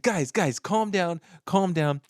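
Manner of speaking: mock-official calming appeal; pleading for calm. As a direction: imitate someone breaking up a squabble. Acted CDC official calming the variants.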